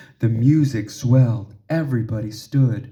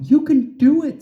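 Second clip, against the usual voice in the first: higher, louder encouraging call; excited higher coaxing; encouraging child; 14 semitones higher, 5 dB louder than usual. A narrator's reading. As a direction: cheer her on to move. Urging frozen Ginger.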